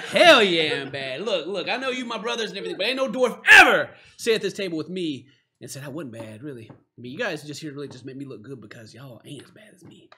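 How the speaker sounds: loud swagger that settles down; bragging dwarf. Acted. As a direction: hold court as the baddest dwarf. Same dwarf keeps boasting.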